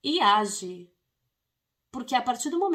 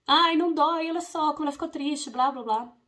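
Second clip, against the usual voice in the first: pleading parent; 6 semitones higher, 5 dB louder than usual. Retold or imitated. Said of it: "ficar falando".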